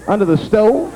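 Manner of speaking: under the stove rising in disbelief; baffled rising question; incredulous question. Real, not acted. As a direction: ask it like you can't believe it. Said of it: "that's what I wanna know".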